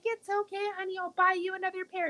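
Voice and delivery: high, quick reassurance; hurried soothing. Retold or imitated. Mom quoting herself promising new boots, high hurried soothing. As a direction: soothe her in a rush.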